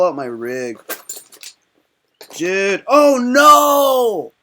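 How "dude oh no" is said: dismayed cry. Real, not acted. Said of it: "I broke my roar stem".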